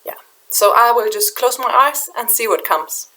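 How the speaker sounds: ordinary talk, nothing marked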